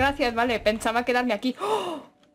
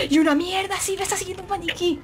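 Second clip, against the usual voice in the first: being chased; 7 semitones higher than usual. Real, not acted.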